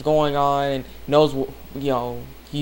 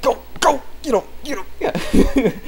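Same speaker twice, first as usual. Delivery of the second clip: cheering; 10 semitones higher than usual. Retold or imitated. Fan cheering at the screen, high and excited. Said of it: "I was like".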